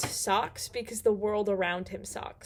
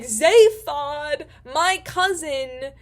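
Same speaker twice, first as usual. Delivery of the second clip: delighted greeting; 4 semitones higher, 8 dB louder, much slower than usual. Retold or imitated. Ford greets his cousin.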